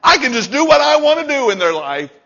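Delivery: high, loud, fast mocking devil with a laugh; gloating devil. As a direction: crow like a devil who got away with it. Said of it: "he does the happy dance".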